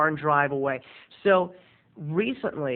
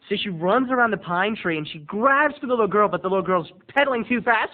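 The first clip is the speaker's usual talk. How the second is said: ordinary talk, nothing marked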